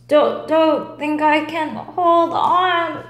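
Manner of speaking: lightly voiced tiring fly; straining fly. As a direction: struggle to stay aloft. Fly struggling to carry.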